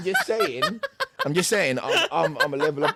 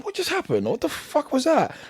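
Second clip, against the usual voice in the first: shocked thought. Retold or imitated. Retold inner shock.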